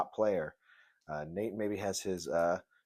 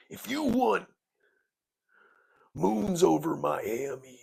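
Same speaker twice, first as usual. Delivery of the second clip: Macho Man impression; 3 semitones higher than usual. Host riffing as the Macho Man about Denny's. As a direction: stretch moons over Miami like a wrestling promo. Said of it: "hear the Macho Man saying that".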